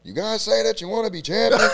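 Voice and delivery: loud, high fired-up coach yelling at players; coach impression. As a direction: bark it like a strength coach chewing out the team. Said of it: "tell me who this is".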